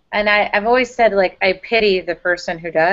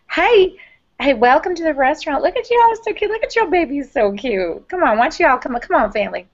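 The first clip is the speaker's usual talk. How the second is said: put-on bubbly Southern accent, much higher pitch, sing-song; Southern waitress welcome; 5 semitones higher than usual. Southern accent, a waitress welcoming a family to her restaurant. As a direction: imitate a sweet Southern waitress greeting you, voice up high. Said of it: "just super Southern".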